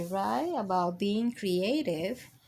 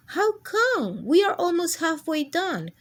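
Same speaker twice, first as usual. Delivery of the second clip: high, loud indignant crayon; shocked protest; 7 semitones higher, 4 dB louder than usual. Acted. Crayon protesting the cancellation notice. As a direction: protest in disbelief.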